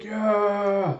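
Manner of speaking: high, held-out cheer; imitated cheer. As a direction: sustain it like a crowd agreeing. Group cheering, acted out.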